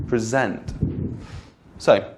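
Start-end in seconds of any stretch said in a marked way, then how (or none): 0.1-0.7 "present" as the verb, pri-ZENT; second-syllable stress. Shown as a demonstration. Teacher explaining the verb, "present" stressed on the end. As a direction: put the weight on the end of "present". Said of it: "you're talking about a verb".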